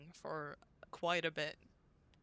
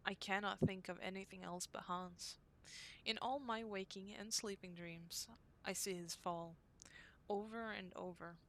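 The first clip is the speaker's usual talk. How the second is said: diary reading; 4 dB quieter than usual. Acted. Reads diary entry from game.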